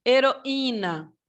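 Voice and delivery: "Heroína" slowly, split as he-ro-Í-na; slow teacher demo of the hiatus in "Heroína"; separated I. Shown as a demonstration. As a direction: stretch "Heroína" with I as its own stressed syllable. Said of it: "O i também aqui separado".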